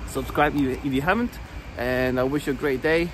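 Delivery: ordinary delivery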